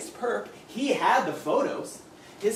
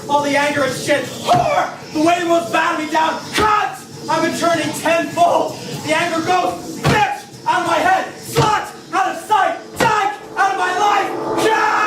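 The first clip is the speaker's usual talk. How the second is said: rising from loud rant to high screamed slurs; building scream; 9 semitones higher, 9 dB louder than usual. Acted. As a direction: let the rage explode with each insult.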